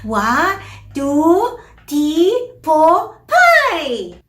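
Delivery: put-on counting like a toddler, endings left off; climbing, emphatic baby-talk count; little kid counting with simplified sounds; child dropping final consonants. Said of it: "what I said in the intro".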